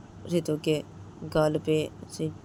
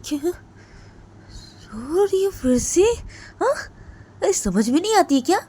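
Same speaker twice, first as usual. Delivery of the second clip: much higher, loud mocking rage; furious taunting; 7 semitones higher, 9 dB louder than usual. Jiya furiously taunting Ritu for crying again. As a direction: taunt her cruelly for crying. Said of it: "Ritu को मारना बंद कर देती है और बोलती है".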